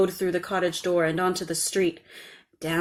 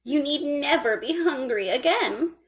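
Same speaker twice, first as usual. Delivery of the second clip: put-on higher, kindly old woman's voice; 7 semitones higher than usual. A narrator's reading. Old woman comforting the crying girl, kindly. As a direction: soothe the girl as a kind old crone. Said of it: "said the old woman".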